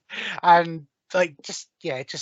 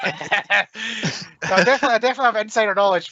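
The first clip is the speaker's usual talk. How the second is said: laughing fast; 5 semitones higher than usual. Real, not acted. Laughing denial after innuendo.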